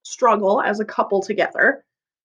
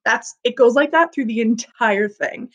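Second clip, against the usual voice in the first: ordinary delivery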